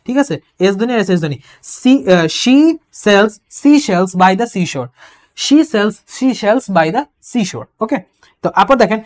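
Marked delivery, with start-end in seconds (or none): 2.2-4.9 she sells by the seashore said very slowly, every s and sh drawn out; alliteration demo. Shown as a demonstration. Teacher slowly reading a tongue twister to the class, sounds drawn out. 5.4-7.6 she sells by the seashore said very slowly, every s and sh drawn out; alliteration demo. Shown as a demonstration. Teacher slowly reading a tongue twister to the class, sounds drawn out.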